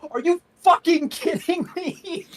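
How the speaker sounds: high, slow, disbelieving yell; outraged bard. Retold or imitated. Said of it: "I just hear my bard go".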